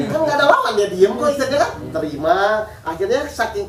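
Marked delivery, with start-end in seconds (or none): 0.1-1.7 laughing. Real, not acted. Laughs telling the tired husband.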